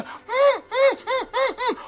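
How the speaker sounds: falsetto hums with a laugh, soft; giggle-hum high as Gretel; hammed-up squeaky moaning giggles; high giggling hums.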